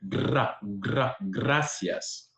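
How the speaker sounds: "gracias" with a drummed Spanish R; rolled R. Shown as a demonstration. Showing how Latinos say it, rolled R. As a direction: trill the R in "gracias", stuttering up to it. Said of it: "Spanish word that has a R".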